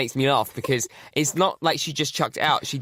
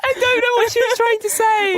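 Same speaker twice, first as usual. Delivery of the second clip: very high, loud squeal through laughter; hysterical laughing shout; 7 dB louder than usual. Real, not acted. Host reacts to friend's accent attempt, shrieking with laughter. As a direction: wail it out, helpless with laughter.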